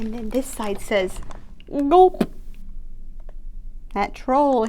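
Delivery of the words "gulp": gulp. Acted. Fish swallowing.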